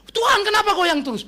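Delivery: high, loud mock protest to God; hammed-up wailing complaint; complaining believer. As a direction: cry out as someone being shaken.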